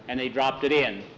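plain reading voice

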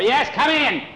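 loud, high call through a door. A narrator's reading. Tom answers a knock at his cabin door, calling out.

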